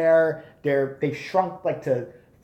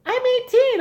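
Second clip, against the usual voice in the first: put-on squeaky boy voice; 5 dB louder than usual. Young teen to usher claiming age, squeaky high voice. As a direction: voice jumps way up on "eighteen". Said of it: "I talk like that still".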